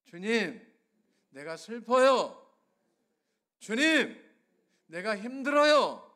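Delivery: rising loud lament, each 주님 called out; crying out prayer. Shown as a demonstration. Preacher leading the congregation in a prayer, loud lament. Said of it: "한번 따라하겠습니다".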